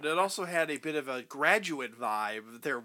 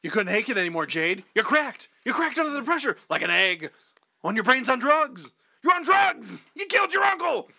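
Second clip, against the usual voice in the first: accusing, building to shouting; 7 semitones higher, 4 dB louder than usual. Pretend. Acted accusation rising.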